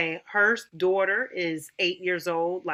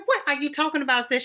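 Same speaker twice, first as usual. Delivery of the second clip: much higher and fast, mock disbelief; incredulous question; 8 semitones higher, 4 dB louder, much faster than usual. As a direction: fire it back fast and high in mock disbelief. Retold baffled reply to friend.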